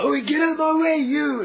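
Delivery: loud, high-pitched mock yell; angry yell. Tough guy to the man he crashed into, mock angry yell. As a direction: act out a brute shoving past.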